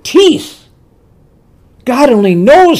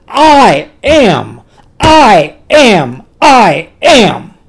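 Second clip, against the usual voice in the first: "I am" hammered out loud, each word stressed; emphatic repetition; 3 semitones higher, 6 dB louder, much slower than usual. Shown as a demonstration. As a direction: proclaim "I am" forcefully, hitting both words. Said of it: "the emphasis is ego eimi".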